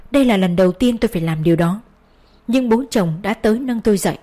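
ordinary delivery, nothing marked

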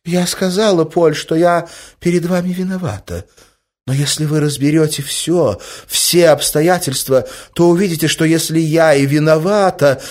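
high, earnest appeal, slowing at the end; hostess pleading her case. A narrator's reading. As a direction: confess and win him over. (проникновенно, оправдываясь)

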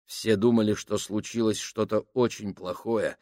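ordinary delivery, nothing marked

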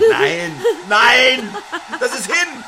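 high, loud despairing cry; anguished denial. Acted. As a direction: refuse to accept the loss. Handy ist hin. (verzweifeltes Nein)

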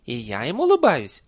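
high, loud, insistent nanny voice; pointed reminder. A narrator's reading. Nanny reminds villager she is a witch too, pointed. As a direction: press the point with each repeat. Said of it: "напомнила нянюшка Як".

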